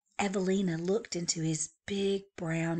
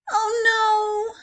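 dismayed cry; 9 dB louder than usual. Acted. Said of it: "cried Evelina".

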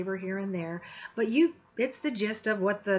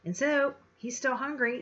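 usual speaking voice

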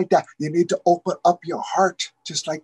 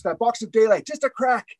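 plain reading voice

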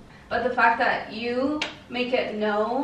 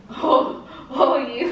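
breaks into laughter; laughing; 5 dB louder, much slower than usual. Real, not acted. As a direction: laugh through the words. Laughs at his joke.

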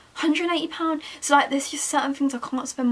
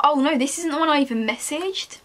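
ordinary talk, nothing marked

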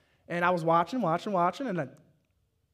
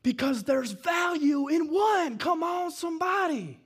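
preaching shout; 7 semitones higher, slower than usual. Real, not acted. Pastor rousing the church toward Easter, shouting. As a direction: drive it home to the pews.

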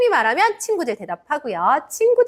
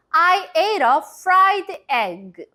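very slow, clearly spaced sentence; slow model sentence; 5 semitones higher, much slower than usual. Shown as a demonstration. Teacher modelling the sentence for kids to repeat aloud. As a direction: say it slowly for repeating. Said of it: "선생님 따라서 큰 소리로 얘기해 보세요".

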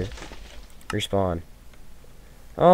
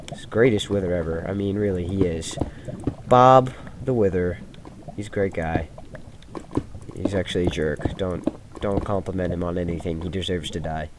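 quiet aside; 7 semitones lower, 7 dB quieter than usual. Real, not acted. Sarcastic aside about boss.

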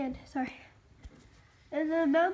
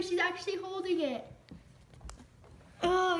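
ordinary talk

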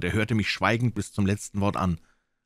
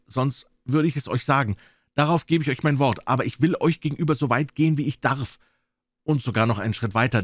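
higher-pitched doctor giving his word; candid doctor; 8 semitones higher, 4 dB louder than usual. Acted. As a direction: confide earnestly in the cook. Doctor confides in Silver.

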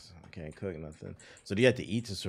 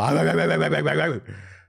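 loud laughing fit; 8 semitones higher, 11 dB louder than usual. Real, not acted. Laughing at crew bark slider.

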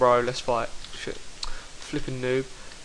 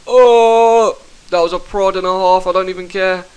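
excited yell; 5 semitones higher, 8 dB louder than usual. Real, not acted. Big hit in PvP fight.

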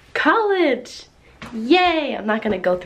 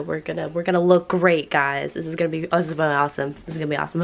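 ordinary delivery

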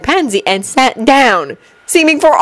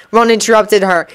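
ordinary delivery, nothing marked

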